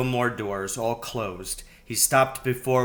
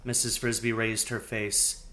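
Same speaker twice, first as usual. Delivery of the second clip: ordinary delivery, nothing marked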